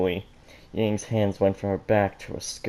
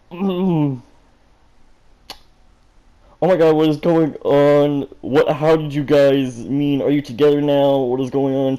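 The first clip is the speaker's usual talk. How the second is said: high, loud panicked babble; loud flustered freakout; frantic shouting; 5 semitones higher, 7 dB louder than usual. Acted. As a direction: rattle off in total shock. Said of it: "speaking so fast".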